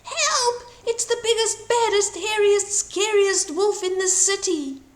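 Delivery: high, loud panicked cries; high frightened shout of old people fleeing. A narrator's reading. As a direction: shout it as you run away.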